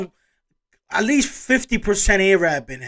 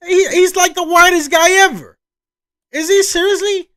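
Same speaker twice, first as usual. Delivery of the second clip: shocked, much higher and louder; can't believe what the list just said; stunned disbelief; 10 semitones higher, 10 dB louder, slower than usual. Real, not acted. Reacting to the ranking video, loud shocked disbelief.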